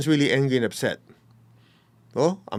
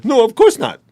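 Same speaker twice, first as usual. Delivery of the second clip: mock protest; 9 semitones higher, 7 dB louder than usual. Mimicking the person's protest.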